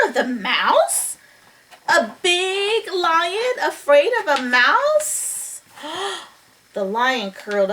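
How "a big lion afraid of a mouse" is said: slow, high-pitched disbelief; incredulous. A narrator's reading. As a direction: draw the question out in amazement. Storyteller incredulous at frightened lion.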